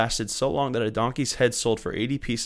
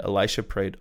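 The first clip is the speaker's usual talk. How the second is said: normal talking voice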